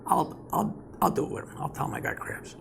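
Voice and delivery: softly whispered aside; hushed, secret whisper of a plan. Retold or imitated.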